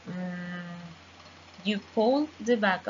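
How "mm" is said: "Mm" as a humming ng through the nose; ng sound. Shown as a demonstration. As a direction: hum a nasal ng, back of tongue raised. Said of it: "the air is coming from here".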